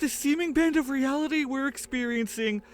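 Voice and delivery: high, loud, weary resignation; Armando's voice. Acted. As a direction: brush off the question, then urge escape. Narrator voicing Armando.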